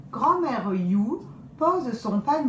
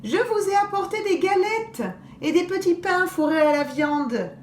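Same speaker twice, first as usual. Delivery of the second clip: higher, sweet fake granny; wolf in grandmother's voice; 10 semitones higher, 4 dB louder than usual. Wolf greeting the girls in grandmother's voice, fake sweet.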